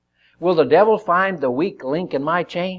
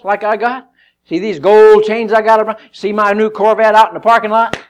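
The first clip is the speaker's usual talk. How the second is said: loud, higher bragging hustler; boastful hustler; 7 semitones higher, 8 dB louder than usual. Acted. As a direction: brag and taunt like a flashy gangster. Preacher plays a flashy tempter.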